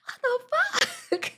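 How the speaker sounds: breathless, laughing under the words; high laughing exasperation; laughing disbelief. Retold or imitated. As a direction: gasp it out mid-laugh. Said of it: "I was like".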